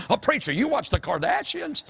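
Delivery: high-pitched mock-shocked question; mock scandalised churchgoer. Imagined church member accusing the preacher, mock scandalised. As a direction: put on a high voice of mock disapproval.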